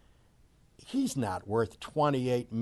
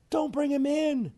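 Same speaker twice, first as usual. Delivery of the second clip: much higher, exasperated cry; angry high outburst; 12 semitones higher than usual. Real, not acted. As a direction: shout it up high in disgust. Yelling at the manager.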